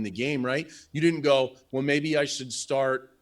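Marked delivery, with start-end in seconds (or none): none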